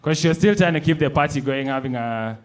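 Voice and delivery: ordinary talk, nothing marked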